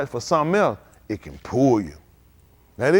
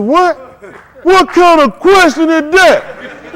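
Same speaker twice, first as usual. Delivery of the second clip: high, loud, slow indignant "what?"; guy stunned by Ryan's question, shocked and loud; shocked outburst; 12 semitones higher, 12 dB louder, much slower than usual. Retold or imitated. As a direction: blurt out scandalized disbelief. Man shocked by blunt question.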